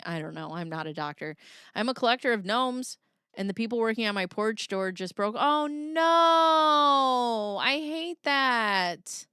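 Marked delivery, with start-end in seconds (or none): 5.4-9.3 long drawn-out, loud "oh no"; dismayed wail. Real, not acted. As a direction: stretch it out high in exaggerated dismay. Dismay at broken porch door.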